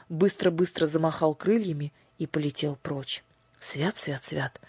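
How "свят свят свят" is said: put-on soft, hushed old woman's prayer; old woman whispering. A narrator's reading. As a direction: breathe it in fright, softly. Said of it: "прошептала бабушка".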